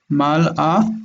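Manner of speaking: Mal'a with a clear stop between mal and a; break at the Aleph. Shown as a demonstration. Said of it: "The Aleph is a break".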